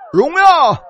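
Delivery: high exultant 荣耀; one word of ecstatic praise, pitched high; cry of glory. Real, not acted. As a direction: call it out like praise. Preacher cries out glory.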